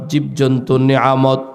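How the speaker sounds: ordinary delivery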